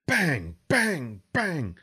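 three loud high bangs, each falling; series of bangs. A narrator's reading. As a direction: hammer out each impact. Big impacts, lights go out.

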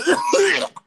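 loud imitated gagging cough; mock heave, loud and sudden; retching cough. Shown as a demonstration. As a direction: cough as if about to vomit. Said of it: "He's gonna vomit".

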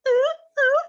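very high, loud ooh; excited squeal. Retold or imitated. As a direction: imitate bubbly squealing. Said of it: "she is just like".

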